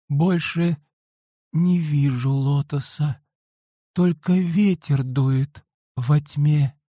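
high, slow, faltering voice; words breaking off from weakness; broken voice. A narrator's reading. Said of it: "прерывающимся голосом заговорила".

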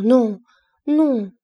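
begging him not to leave; stammered plea. A narrator's reading.

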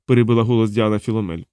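plain reading voice

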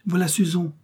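high, eager call of the name; a mother calling out the girl's arrival; cried out. A narrator's reading. The old woman announcing Suzon at the door, high cry. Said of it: "cria la bonne femme".

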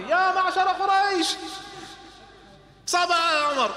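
high, loud, slow town-crier call. Acted. Jamil crying out to Quraysh at the Kaaba, loud and drawn. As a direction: announce it to the whole of Quraysh.

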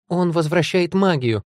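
high, slow exclamation; crying out. A narrator's reading. Heroine explaining to attacking lord, high cry. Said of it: "крикнула я".

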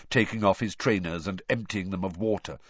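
plain reading voice

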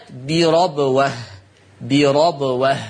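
"بربوة" as birabwa, open a after the r; فتح الراء. Shown as a demonstration. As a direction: slowly say "بربوة" with ra, as Hafs reads. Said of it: "حفص عن عاصم يقرأ بفتح الراء". ("بربوة" بفتح الراء على قراءة حفص)

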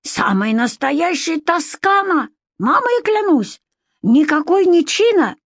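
put-on high, indignant accented cry; swearing on his mother, offended; indignant flare-up. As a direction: bristle and protest. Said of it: "буквально взвился".

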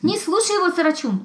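a high warning shout. A narrator's reading. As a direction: cry out urgently, hedgehog warning a friend. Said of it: "закричал ежик".

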